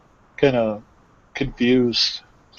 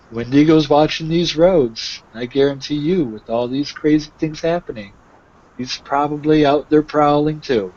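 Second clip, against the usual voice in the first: a cheerful traveler, warning of the Wendigo; Lucia's farewell; 3 semitones higher than usual. Acted.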